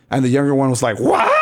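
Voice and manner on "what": very high mock shriek; hammed-up retold yelp of indignation; upset, shocked. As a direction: squawk it in outraged disbelief. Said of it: "Totally upset about it".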